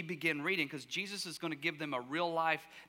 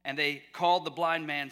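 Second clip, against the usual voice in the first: ordinary talk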